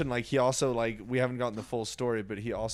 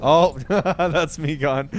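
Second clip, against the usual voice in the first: laughing; 5 semitones higher, 9 dB louder, slower than usual. Real, not acted. Laughing at gross item.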